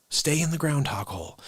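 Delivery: usual speaking voice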